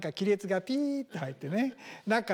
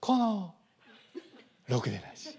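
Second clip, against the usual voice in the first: Morimoto Leo style. Retold or imitated. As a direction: say the insult like a soothing lullaby. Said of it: "森本レオ風だと".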